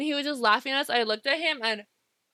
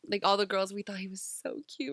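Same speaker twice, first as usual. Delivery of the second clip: normal talking voice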